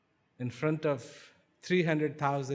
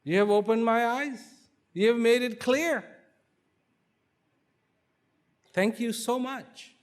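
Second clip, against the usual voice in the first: higher, louder heartfelt thanks; fervent gratitude; 9 semitones higher, 6 dB louder than usual. Acted. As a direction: pour out your thanks, overwhelmed. King thanks wise man effusively.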